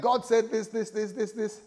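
high-pitched, tumbling list; excited. Acted. Abraham telling his pastor what God said, excited. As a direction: reel it off high with acted excitement. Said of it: "And Abraham is excited".

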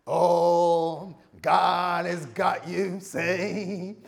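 a visiting preacher firing up the church, booming; American hype preacher. Shown as a demonstration. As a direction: belt it out to stir the congregation. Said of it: "I'll bring a few folks here".

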